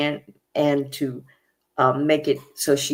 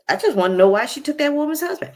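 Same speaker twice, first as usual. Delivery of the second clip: high, fast insistent question; defiant repeat; 11 semitones higher, 4 dB louder, much faster than usual. Retold or imitated. Cast member refusing to back down at dinner, high fast defiant question. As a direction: fire it off high and quick, standing your ground. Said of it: "She's like, "What?".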